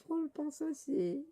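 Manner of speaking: very high, small cartoon voice; high-pitched little character. Reading the manga's little companion aloud, high-pitched little character. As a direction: pipe it up high.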